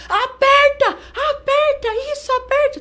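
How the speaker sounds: very high, loud urging cries; imitated corner shouting. Corner urging the fighter during the choke, imitated high urgent yells. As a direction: mimic coaches screaming her on to squeeze. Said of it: "depois eles estavam".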